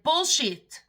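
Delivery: retelling the stranger's loud, disbelieving outburst; retold loud scoff. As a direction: bark it back as the man who won't believe her. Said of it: "He said".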